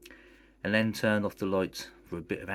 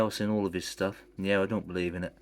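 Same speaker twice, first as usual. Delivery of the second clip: ordinary delivery, nothing marked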